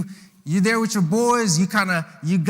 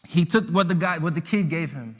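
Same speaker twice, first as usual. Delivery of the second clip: usual speaking voice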